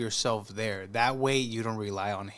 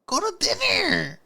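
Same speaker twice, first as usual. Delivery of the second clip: loud, high incredulous outburst; high exasperated holler; exasperated heckling; 14 semitones higher, 9 dB louder than usual. Real, not acted. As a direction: yell at her in high-pitched disbelief. Heckling the woman's date choice.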